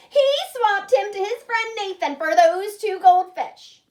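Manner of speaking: loud, high tattle; tattling. Acted. Little sister telling on her brother, loud tattle. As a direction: blurt it out to mom.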